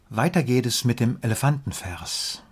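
ordinary talk

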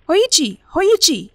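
high, loud calls into the rain; shouting his name. A narrator's reading. The servant shouts for Hoichi through the rain, high and loud. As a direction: shout for him through the storm.

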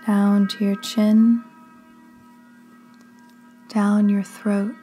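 slow, hushed delivery in short phrases with long pauses; drawn-out words, each phrase left to settle; guided sleep meditation. Acted. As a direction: lead them gently down into sleep, unhurried.

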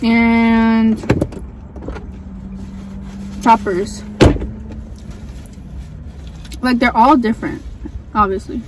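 chewing while talking; eating chicken while speaking; mouth full of food. Real, not acted. Seen 0: Bites wing just before, speaks with cheek working, food in hand.

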